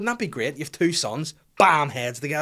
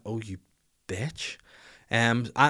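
normal talking voice

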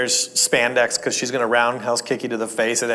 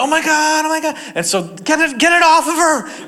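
much higher, frantic mock panic; 13 semitones higher, 3 dB louder than usual. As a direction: play the family flapping over a crying kid. Family panicking over crying child.

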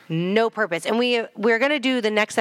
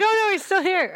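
mock Xander; 10 semitones higher than usual. Mocking Xander's scared reaction.

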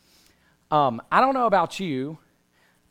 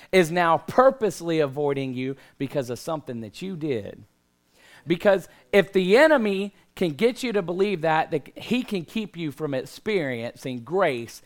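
preaching; 2 semitones higher, 3 dB louder than usual. Real, not acted. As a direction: preach it with full force. Preaching at full force.

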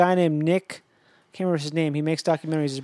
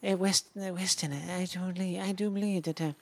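a quieter, lightly done British documentary narrator; British aristocrat; 7 dB quieter than usual. Retold or imitated. Imitating a documentary narrator for friends, lightly British. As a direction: mumble it like a posh British narrator. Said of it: "the guy saying".

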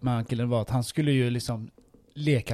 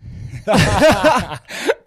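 loud high laugh; 8 semitones higher, 8 dB louder than usual. Real, not acted.

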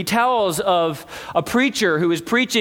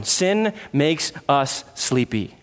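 Sin makes us sleepy, drawn out slow and low; low, slow emphasis on Sin; slow, weighty; 6 semitones lower, slower than usual. Shown as a demonstration. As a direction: lay each word down slowly, like a verdict. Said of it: "Just mark that down".

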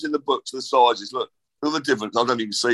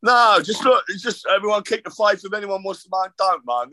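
rallying call; 7 semitones higher than usual. Real, not acted. Rallying fans at the end.

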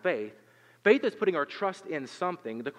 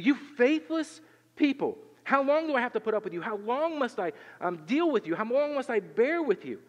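mad Jesus; 8 semitones higher than usual. Acted. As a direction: vent it like a worn-out teacher. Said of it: "you ever hear Jesus get mad like this?".

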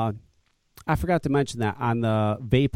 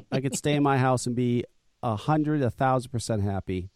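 normal talking voice

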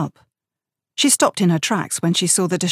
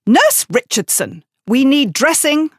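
louder, higher call across the room; 7 semitones higher, 5 dB louder than usual. Acted. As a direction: summon the nurse from the doorway. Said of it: "Matron called from the doorway".

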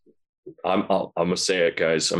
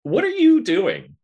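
exclaiming; 13 semitones higher, 4 dB louder, slower than usual. Acted. Calling out to viewers.

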